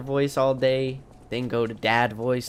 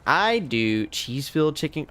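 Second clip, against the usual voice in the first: ordinary delivery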